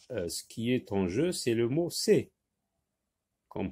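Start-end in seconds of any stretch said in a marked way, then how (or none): none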